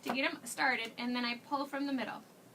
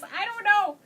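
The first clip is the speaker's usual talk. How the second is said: exasperated exclaim; 8 semitones higher, 11 dB louder than usual. Real, not acted. Exasperated about French.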